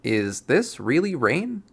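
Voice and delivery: ordinary delivery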